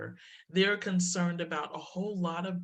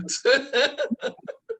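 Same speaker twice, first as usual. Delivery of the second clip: a rising peal of laughter; high laugh; 9 semitones higher, 6 dB louder than usual. Real, not acted. Laughing at the shoppers' stares.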